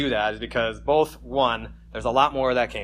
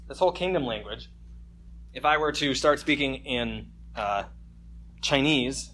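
ordinary talk

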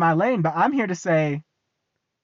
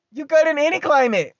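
louder, higher hype shout; louder, higher excited declaration; 6 semitones higher, 5 dB louder than usual. Real, not acted. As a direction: sell it like the best deal ever. Hyping free heated blankets.

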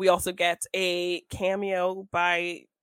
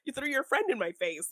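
mock argument; 7 semitones higher than usual. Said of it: "He's like".

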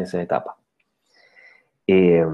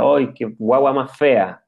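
higher, louder, slow mock dismay; dad's dismayed exclamation; 4 semitones higher, 5 dB louder, slower than usual. Father reacting to his newborn, hammed-up dismay. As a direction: ham up a father let down by his baby's looks. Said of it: "Basta con que el papá diga".

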